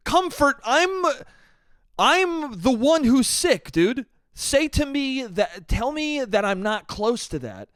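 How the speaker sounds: high, slow pleading; pleading outburst. Retold or imitated. Retelling his plea to the doctor, high and slow. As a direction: beg him to comfort you. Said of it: "And I'm like".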